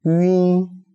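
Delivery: "Yun" held high and level; teacher modelling "Yun" as one long syllable; demonstrated pronunciation. Shown as a demonstration. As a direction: draw out "Yun" on a steady high pitch. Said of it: "So the pronunciation is yun".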